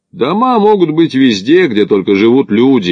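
ordinary delivery, nothing marked

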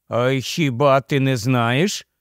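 ordinary delivery, nothing marked

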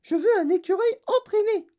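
very high, loud shriek; demanding spoilt girl. A narrator's reading. Spoilt girl insisting to mother, shrieking.

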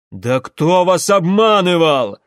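loud, slow exasperated question; losing patience. A narrator's reading. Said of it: "потерял терпение отец". (крик потерявшего терпение)

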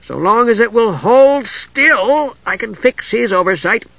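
high, drawn-out words with mock severity; severe kender. A narrator's reading. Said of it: "added severely".

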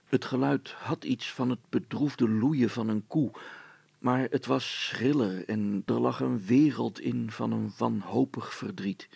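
usual speaking voice

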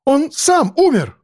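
high, drawn-out exclamation; desperate cry. A narrator's reading. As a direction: insist at a high pitch, stretching it. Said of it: "выкрикнул он".

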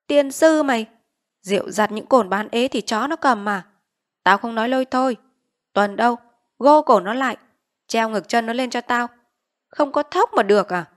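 bully the debtor with rough commands; angry village chief. Acted. Village chief cursing a debtor, ordering him tied, angry bark. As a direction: curse him out, barking orders.

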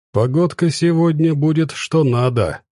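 plain reading voice

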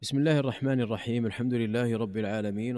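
ordinary delivery

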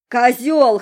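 put-on loud slurred drunken yell; drunk woman voice. A narrator's reading. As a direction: bellow it like a tipsy girl. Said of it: "сказала она пьяным голосом".

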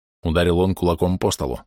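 ordinary delivery, nothing marked

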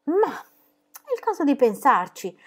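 slow, high musing; drawn-out considering tone; thoughtful. Acted. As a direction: Pippi pondering, slow and high. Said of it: "disse Pippi".

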